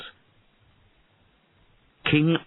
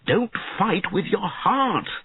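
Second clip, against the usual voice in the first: slow, high-pitched admonishing; earnest, drawn-out plea in a raised pitch; earnest admonishment; 13 semitones higher than usual. A narrator's reading. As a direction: press the lesson on your pupil. Mentor admonishes the fallen boy.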